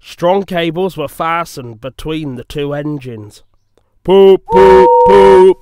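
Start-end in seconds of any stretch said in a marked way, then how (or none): 4.0-5.6 loud, high train toots; engine whistle. A narrator's reading. Engines signal the pull, loud whistle hooting. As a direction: hoot it out like a steam whistle.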